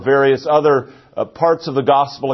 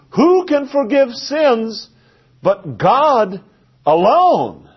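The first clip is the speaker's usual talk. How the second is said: higher, slow, scandalised challenge ending on alone; outraged scribes; 8 semitones higher, slower than usual. Retold or imitated. Scribes protesting to each other, high and slow with outrage. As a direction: spell out each word as if it were blasphemy. Scribes accuse Jesus of blasphemy.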